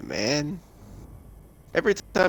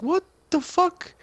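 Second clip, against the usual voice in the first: incredulous; slower than usual. Retold or imitated. Retold incredulous reaction.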